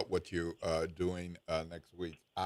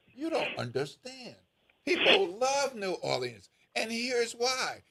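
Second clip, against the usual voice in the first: high-pitched, insistent advocate; impassioned retort; 14 semitones higher than usual. Retold or imitated. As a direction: plead the city's case in a raised pitch. Acted retort in retold exchange.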